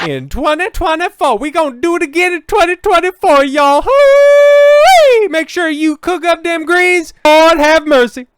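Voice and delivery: mock Southern church-lady accent, high and loud; mocking Kamala Harris's Southern accent. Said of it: "what's her new accent?".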